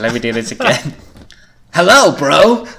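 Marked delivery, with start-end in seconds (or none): 1.7-2.7 higher, louder surfer greeting; surfer dude. Acted. Scott greets his brother at the door, surfer voice. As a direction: hail your brother like a surfer. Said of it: "going for a bit of a surfer motif".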